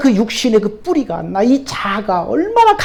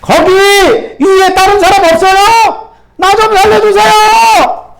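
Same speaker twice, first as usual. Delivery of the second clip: much higher and louder, slow, drawn-out yelling; desperate shout; 9 semitones higher, 7 dB louder, slower than usual. Acted. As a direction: call past God for someone else. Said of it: "소리치는 거예요".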